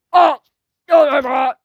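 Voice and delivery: high, loud mock protest; pretend dismayed shout. Objecting in pretend play, high loud cry.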